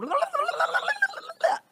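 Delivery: very high warbling imitation of a tape being chewed; mangled tape noise. Shown as a demonstration. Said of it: "it sounds like the eighties ... eating my cassette tape".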